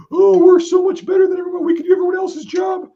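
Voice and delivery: high, loud sneering imitation of boastful MPs; mocking braggart. Mocking MP bragging to soldier, high and loud. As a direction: ham up the smug brag, mile high.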